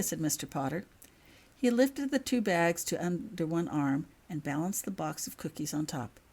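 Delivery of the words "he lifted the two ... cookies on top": ordinary delivery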